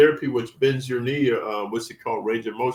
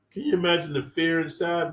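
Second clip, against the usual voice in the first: usual speaking voice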